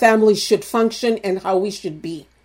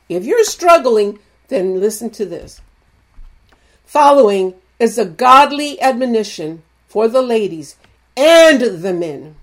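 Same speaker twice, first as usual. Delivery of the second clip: usual speaking voice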